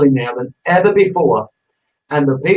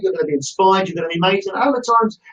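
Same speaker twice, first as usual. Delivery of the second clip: ordinary delivery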